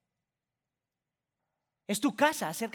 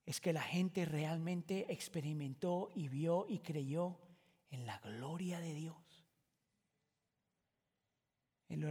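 slow, soft, lower worshipful voice; softer slow preaching near a whisper; reverent hush; 3 semitones lower, 8 dB quieter, slower than usual. Acted. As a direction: let the words fall away in awe. Hushed reverent climax of point.

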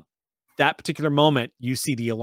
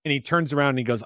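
ordinary talk

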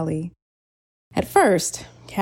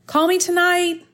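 shouted; 11 semitones higher than usual. Acted. Callie shouting to Julian through the bus window. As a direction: call out after the leaving bus. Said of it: "Callie shouted at the bus window".

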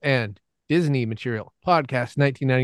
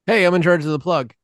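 loud, higher, punchy call-out; announcer-style exclamation; 5 semitones higher, 5 dB louder than usual. Pretend. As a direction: boom out the announcement. Taking up the plug duty.

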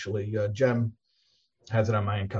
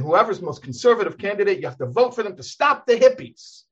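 raised, urgent preaching voice; impassioned sermon, higher; 7 semitones higher than usual. Retold or imitated. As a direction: hammer the point like a fiery rabbi. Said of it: "it's very harsh language".

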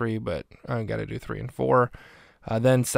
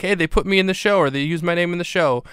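higher, eager mock excitement; pitched-up imitation of a delighted fan; excited; 5 semitones higher, 4 dB louder than usual. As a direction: chirp it out like a kid thrilled. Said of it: "he's excited".

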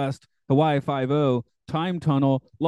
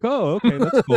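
mock casual reply; 11 semitones higher, 6 dB louder than usual. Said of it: "He's like".

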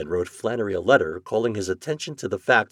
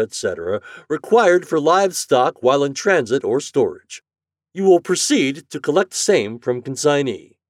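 loud, raised official dictation voice; decisive department head; 7 semitones higher, 7 dB louder than usual. A narrator's reading. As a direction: dictate it briskly to a secretary, word by emphatic word. Morgan reacts, then dictates a letter.

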